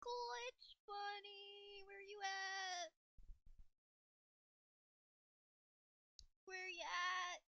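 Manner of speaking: high, drawn-out searching call; high playful hide-and-seek call; sing-song calling. Pretend. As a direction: draw it out like calling someone hiding. Calling out for Glitch Bunny.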